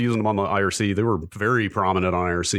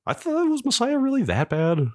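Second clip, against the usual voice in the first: incredulous. Real, not acted. Incredulous question to co-host.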